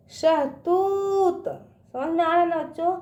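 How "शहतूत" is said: slow, higher शहतूत, तू held very long; drawn-out long ū. Shown as a demonstration. Teacher drilling children, शहतूत with drawn-out ū. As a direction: stretch the ū in शहतूत. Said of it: "इसका उच्चारण ना खींच के करते हैं".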